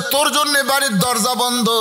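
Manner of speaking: high-pitched preacher's version of an angry father; retold banishment, pitched high. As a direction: declaim the father's anger in a high chant. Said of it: "বাবা রাগ করে বলেছে".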